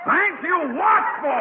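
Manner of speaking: high, loud, slow double-take shout; startled outburst. Acted. Principal blurts it out, startled in the dark closet. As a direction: bark it out caught off guard.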